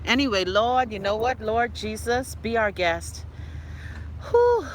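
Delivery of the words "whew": a sharp high whew to blow off steam; high exhale whew. Real, not acted. Venting to viewers, a high exhale of relief. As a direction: whew out the anger. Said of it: "Let me breathe".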